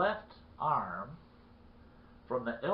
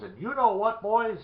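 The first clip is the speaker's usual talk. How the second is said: high, loud lead-up to a punchline; acted emphatic warning; 10 semitones higher, 8 dB louder than usual. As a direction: call out to the boys, all worked up. Bloodied man warns the others.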